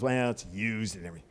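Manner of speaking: low, quiet imitated husband; soft, low-pitched grumble; grumbling objection. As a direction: mumble a half-hearted objection. Retold husband's reluctant objection.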